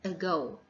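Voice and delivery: "ago" as uh-GO; light schwa on the "a" of "ago", weight on "go"; stress on second syllable. Shown as a demonstration. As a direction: lean on the last syllable of "ago". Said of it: "falls on the second syllable".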